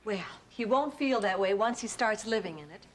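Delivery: usual speaking voice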